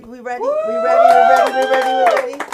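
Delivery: rising hype call to the crowd; hype shout. Real, not acted. Host pumping up the crowd, shouting.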